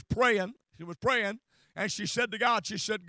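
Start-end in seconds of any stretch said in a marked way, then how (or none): none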